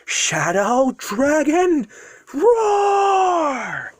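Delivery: drawn-out, high-pitched battle cry; yelled attack. Acted. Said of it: "breathes deeply and yells".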